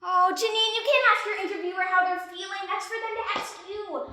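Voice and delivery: ordinary talk, nothing marked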